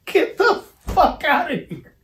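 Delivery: high, laughing get out; laughing disbelief. Real, not acted. Incredulous reaction to the request.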